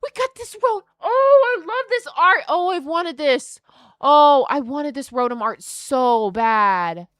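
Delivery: very high, loud joyful shrieks; screaming with joy. Real, not acted. Pulling the Rotom she wanted, loud high joyful screams. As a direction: scream it like a dream came true. Finally pulls wanted card.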